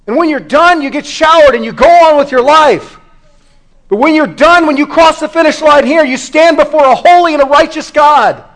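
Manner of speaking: high, loud, impassioned sermon; full-force preaching. Real, not acted. Preacher warning congregation they will face God, full-force preaching. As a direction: preach it hard, pitch and volume up.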